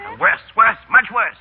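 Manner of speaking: mock grumble. Complaining about his cold.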